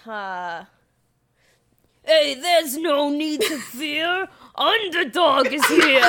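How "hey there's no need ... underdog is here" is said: slow, loud wise-guy hero motto; Underdog. Acted. Friend says the dog's classic motto on request, hammed-up loud hero voice. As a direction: announce it as a brash cartoon hero, building louder. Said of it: "say his classic motto".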